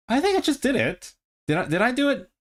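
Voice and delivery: thrilled at getting it right; excited surprise. Real, not acted. Learner startled at finally trilling, high excited voice.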